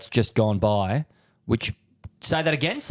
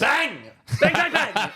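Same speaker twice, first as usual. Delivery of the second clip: excited; 13 semitones higher than usual. Real, not acted. Celebrating a correct answer.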